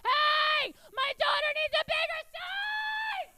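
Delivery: high, loud, slow store-wide shout; screaming mom. Retold or imitated. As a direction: broadcast it to the whole store. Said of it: "scream out that I needed a bigger size".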